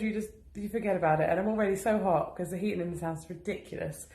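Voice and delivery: usual speaking voice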